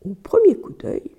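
ordinary delivery

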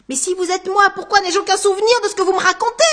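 high, loud and rushed, near panic; panicked outburst. Acted. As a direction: blurt it out in disbelief, fast. Anguste panics at his double. (Anguste affolé crie à son double, voix aiguë et précipitée)

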